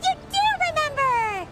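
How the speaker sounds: eager high-pitched delight; excited. Acted. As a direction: bounce with joy that he remembered. Companion delighted he remembers.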